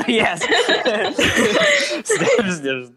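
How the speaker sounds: high, laughing delivery. Real, not acted. Amused by someone asking Steph to marry them, laughing. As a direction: talk through real laughter.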